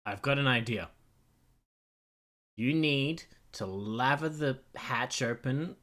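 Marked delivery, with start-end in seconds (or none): 2.6-5.8 slow, earnest mock advice; deadpan slow suggestion. Streamer trolling the AI with a silly plan. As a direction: spell out the absurd idea slowly.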